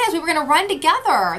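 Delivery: ordinary delivery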